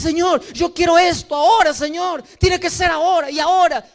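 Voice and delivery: loud, high, insistent plea for it now; impatient demand. Retold or imitated. Believer insisting God give it now, loud and high. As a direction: push the Lord like a spoiled child. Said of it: "Y dices".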